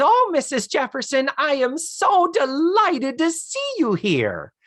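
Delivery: gushing hostess. Retold or imitated. Lillian gushing over Louise Jefferson, high and sugary. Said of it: "you're nailing your Isabel Sanford".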